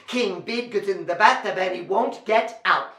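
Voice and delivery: brisk, even recital; fast. Shown as a demonstration. Storyteller leading children in the refrain, fast. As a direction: rattle it off quickly. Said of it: "Can you say it fast?".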